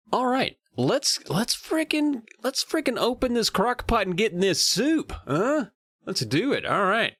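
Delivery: high-pitched eager pep talk; rally them to dig in, pitch way up; hyped-up kickoff. Real, not acted. Hypes up start of book discussion.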